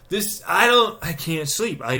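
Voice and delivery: usual speaking voice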